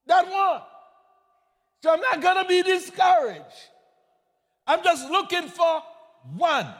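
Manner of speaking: fiery preacher building to a shout; preaching at full force. Acted. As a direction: drive it home to the congregation.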